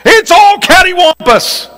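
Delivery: high, hammed-up Southern exclamation; Southern drawl. Shown as a demonstration. Preacher demonstrates a favorite Southern phrase in a high twang. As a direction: toss it off like a folksy Southerner. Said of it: "Catty corner, though ... cattywampus".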